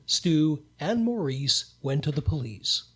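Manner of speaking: usual speaking voice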